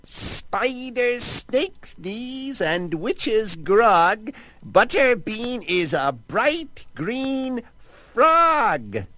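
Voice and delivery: high, slow chanted witch spell; hissed spell. Acted. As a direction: cast it slowly like a wicked witch's curse. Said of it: "and she hissed".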